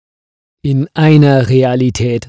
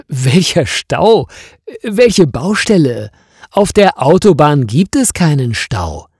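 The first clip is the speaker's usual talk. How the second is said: higher, louder, baffled; he can't believe what she's saying; surprised; 5 semitones higher, 5 dB louder than usual. Acted. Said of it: "erklärt Franz überrascht". (überrascht, höher und lauter)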